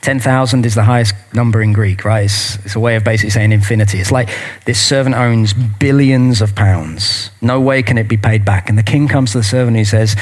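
ordinary talk

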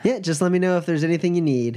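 brisk friendly waitress; diner waitress. Acted. Bartender signs off.

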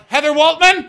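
high, loud outburst of a name; mock bitter yell. Comic names the girl who refused him prom, high bitter yell. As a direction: yell it out like an old grudge.

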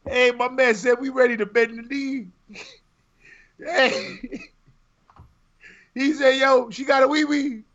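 very high, squealing mock quote; hysterical teasing. Real, not acted. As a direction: crow it while helpless with laughter.

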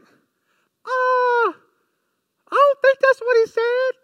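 very high, loud squeaky woman's voice; hammed-up high Eve voice. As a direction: pitch way up and belt a silly Eve. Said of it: "That's my Eve voice".

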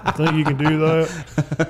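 slow, laughing Southern drawl on "You think you can do that?"; mock Southern accent. Club owner's question redone in a joking Southern drawl. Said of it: "And he didn't have a Southern accent".

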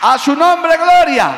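loud, high shout of glory; shouting praise. Real, not acted. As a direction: cry out praise to the rafters. Preacher exclaims praise.

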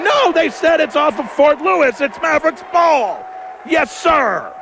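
jubilant, high excited shout; excited high call. Real, not acted. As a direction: cheer the call going your way. Call reversed, joyful reaction.

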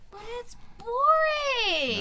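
high, loud wail with a drawn-out BORING; loud whiny protest. Real, not acted. Student objecting that no drama is dull, loud whiny protest. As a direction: stretch it out and belt it in complaint.

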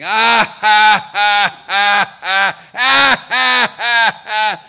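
a gloating demon roaring with laughter; demon laughing. Acted. Lavanasur laughs while the demigods weep, booming laugh. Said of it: "And Lavanasur was just laughing".

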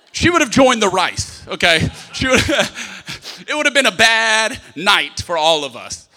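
loud, impassioned sermon delivery; fiery preaching. Acted. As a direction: preach it hard to the crowd. Preaching with full force.

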